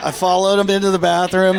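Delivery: normal talking voice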